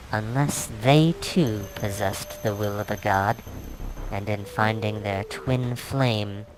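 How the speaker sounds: ordinary delivery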